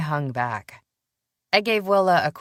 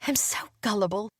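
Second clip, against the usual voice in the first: put-on sheepish young woman; 6 semitones higher, faster than usual. A narrator's reading.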